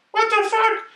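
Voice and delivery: slow, mock falsetto outburst; shrill high-pitched swearing character; squeaky high voice. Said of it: "such a high voice".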